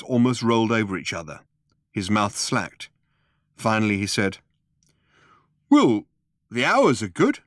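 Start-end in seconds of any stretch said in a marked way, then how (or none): 5.7-7.4 loud, high, ponderous answer; dim Vogon guard. A narrator's reading.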